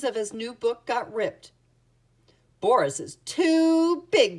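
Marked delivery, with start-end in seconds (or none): 2.6-4.4 high, loud cry with drawn-out words. A narrator's reading. Little mouse complaining about the big bear, crying out. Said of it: "cried the little mouse".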